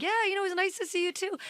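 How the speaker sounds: fast, breezy, high brush-off; forced politeness. Retold or imitated. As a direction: rush it with fake cheer. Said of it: "and then I was like".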